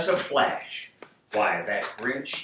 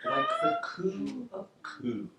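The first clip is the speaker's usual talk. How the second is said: high, soft cooing; dove coo; 7 dB quieter than usual. Shown as a demonstration. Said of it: "that's better".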